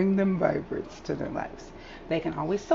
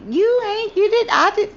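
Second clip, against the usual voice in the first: higher, put-on squabbling voice; mimicking coworkers arguing; mocking the ladies; 13 semitones higher, 6 dB louder than usual. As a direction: parody their petty bickering. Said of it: "even down to the ladies".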